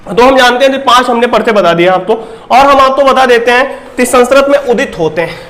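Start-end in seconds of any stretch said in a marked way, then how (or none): none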